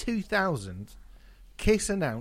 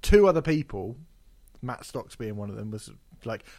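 ordinary talk, nothing marked

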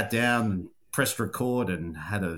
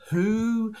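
Mick Malloy impression; 7 semitones higher, 4 dB louder than usual. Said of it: "as Mick Malloy would say".

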